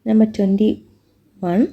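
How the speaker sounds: ordinary talk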